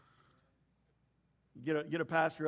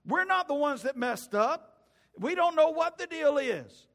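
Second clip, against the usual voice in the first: raised, higher-pitched defensive protest; arguing back; 12 semitones higher, 10 dB louder than usual. Retold or imitated. Disciples arguing back at the religious leaders, high defensive protest. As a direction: protest loudly that you are not to blame. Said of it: "he hears the disciples going back".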